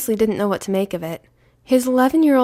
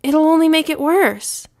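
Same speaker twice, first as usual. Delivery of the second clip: put-on pleading boy; 8 semitones higher, 6 dB louder than usual. A narrator's reading. Son pleading with father.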